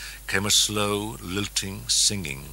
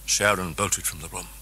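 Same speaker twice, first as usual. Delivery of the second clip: usual speaking voice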